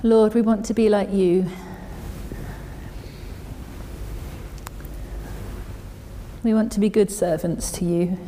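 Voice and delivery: soft, hushed prayer with long silences. Real, not acted. Preacher asks the Lord to make them good servants, quiet prayer. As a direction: pray quietly, leaving space after each petition. Closing prayer after the reading.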